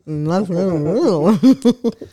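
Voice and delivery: talking through a mouthful; words muffled round a full mouth. Real, not acted. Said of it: "She's talking with her mouth full".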